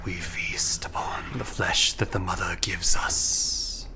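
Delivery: slow eerie whisper; a starving tree hissing through leaves; talking tree. Acted. GM voices the tree.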